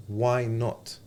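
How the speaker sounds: ordinary talk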